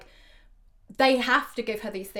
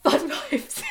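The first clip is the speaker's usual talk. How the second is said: amused laughing echo; 7 semitones higher, 5 dB louder than usual. Real, not acted. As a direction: laugh while repeating it.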